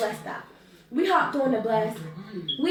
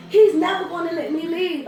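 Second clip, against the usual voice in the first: raised, louder whine; mock tearful; 4 semitones higher, 5 dB louder than usual. Crying cousin answering her comforter, mock tearful wail. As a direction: wail it like a kid having a fit. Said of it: "she's sitting up there crying".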